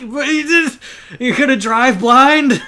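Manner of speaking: loud, very high, drawn-out disbelief; incredulous. Retold or imitated. Host retells reaction to older drivers, high and incredulous. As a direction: squawk it in stunned protest.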